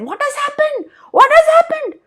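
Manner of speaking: high, startled cry; alarmed gods. Acted. Gods in heaven crying out at the darkness, very high and alarmed. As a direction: gods panicking at the sudden dark.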